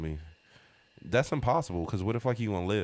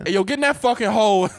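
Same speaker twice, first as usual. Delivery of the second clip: yelling boss; 10 dB louder than usual. Pretend. Boss yelling at tiny worker.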